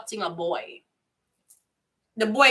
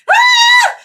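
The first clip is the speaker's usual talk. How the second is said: sudden high, loud scream; high scream of mock outrage; shocked shriek; 15 dB louder than usual. Real, not acted. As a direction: let out a startled yelp of disbelief. Shrieks at dog's birth paper.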